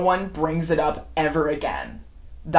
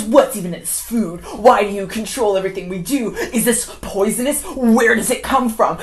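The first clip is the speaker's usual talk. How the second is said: raised-voice mock interrogation; agitated demanding questions; 5 semitones higher, 6 dB louder than usual. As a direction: fire off alarmed, accusing questions. Acting out wife's agitated questioning.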